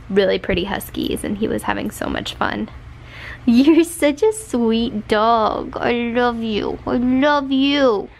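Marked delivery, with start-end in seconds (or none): 3.5-8.1 doting coo. Real, not acted. Owner praising her sweet dog, doting coo. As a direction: stretch every word lovingly to a dog.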